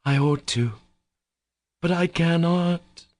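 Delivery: high, drawn-out moan of reluctance. A narrator's reading. Katya gives way to Alyosha's pressure, moaning. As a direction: moan it out high, slowing at the end. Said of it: "Katya moaned".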